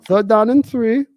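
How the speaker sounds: loud mock German play-by-play call; German accent. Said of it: "play-by-play all in German accent".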